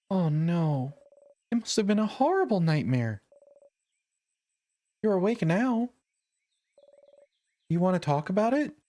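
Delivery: a friend checking on him, concerned; worried cheerful girl. Acted. Voices Mirabelle, comforting.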